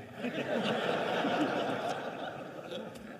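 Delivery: a real unguarded laugh; big laugh. Real, not acted. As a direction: laugh out at the memory. Laughing at his own story.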